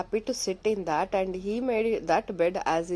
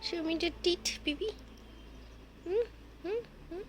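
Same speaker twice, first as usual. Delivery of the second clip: very high, slow, coaxing; 10 semitones higher, 7 dB quieter, slower than usual. Real, not acted. Owner coaxing her teething puppy, high cooing baby talk. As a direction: coo at the puppy to show its teeth.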